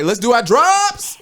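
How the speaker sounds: high, loud, drawn-out hype call; stretched-out, booming cue for the intro; loud announcer call. Real, not acted. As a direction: belt it out to kick off the show. Announcing the show's intro drops.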